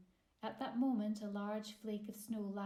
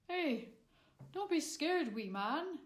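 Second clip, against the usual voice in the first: gentle giant; 7 semitones higher, 6 dB louder than usual. A narrator's reading. Giant soothing the boy.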